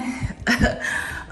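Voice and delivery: quick breathy giggle; small laugh to herself; short laugh. Real, not acted. Vlogger amused at calling herself grown, short giggle.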